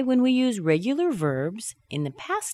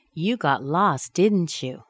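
"didn't you" falling, confident; falling tag for confirmation; slower than usual. Shown as a demonstration. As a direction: drop DIDN'T YOU, just checking. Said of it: "I'm asking for confirmation".

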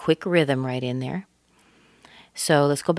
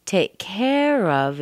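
CARE stretched long and raised; stress on CARE; 4 semitones higher, much slower than usual. Shown as a demonstration. Teacher points out the key phrase, stressing CARE. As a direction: stretch CARE high in "take care of". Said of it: "is very important in here".

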